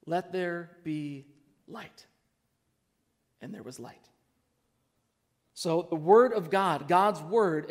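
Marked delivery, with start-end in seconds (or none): 0.1-4.1 hushed reverence. Acted. Pastor reading God's first words, hushed and slow. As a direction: drop to a slow near-whisper on "let there be light".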